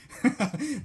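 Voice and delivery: short rising laugh; a quick real laugh breaking out; amused laugh. Real, not acted. Host laughing at obvious research findings, amused laugh. Laughs at his own reaction.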